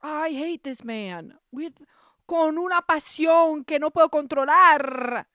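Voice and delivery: high, loud melodramatic declaration; hammed-up passionate rant. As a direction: build from loud loathing to a theatrical Spanish bellow.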